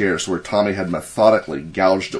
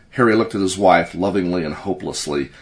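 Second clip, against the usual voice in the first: ordinary delivery, nothing marked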